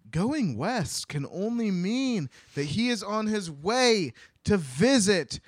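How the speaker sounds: high, loud, swelling proclamation; dramatic announcement. Acted. Storyteller revealing the dreaded villains, dramatic announcement. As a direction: ham up the big reveal, stretching the name.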